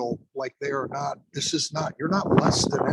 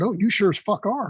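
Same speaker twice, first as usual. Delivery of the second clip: pitched up, mock-agreeing jab; teasing retort; 7 semitones higher than usual. Instructor ribbing a nervous student with mock agreement. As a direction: tease him, all cheerful agreement. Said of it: "I said".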